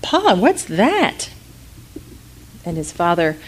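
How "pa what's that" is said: put-on higher, slow wondering child; a small boy asking his dad; curious boy. Boy asking his father about the giant, wondering. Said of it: "And the boy said".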